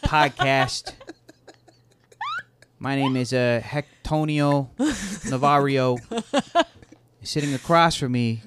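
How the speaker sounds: lightly hammed New York wiseguy intro; a touch of New York gangster host; New York mobster accent. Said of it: "in that accent".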